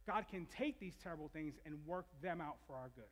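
usual speaking voice

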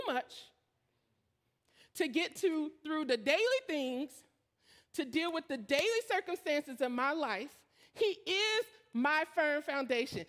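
rising, fervent sermon voice; full-throated pulpit preaching. Acted. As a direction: preach it with building conviction. Preaching builds.

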